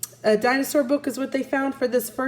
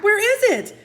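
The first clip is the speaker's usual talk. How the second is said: put-on much higher and louder panicked boy; mildly voiced frantic boy; frantic search; 12 semitones higher, 4 dB louder than usual. A narrator's reading.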